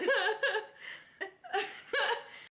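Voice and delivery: loud high cackle; high laugh. Real, not acted. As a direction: burst out laughing. Laughing at the joke.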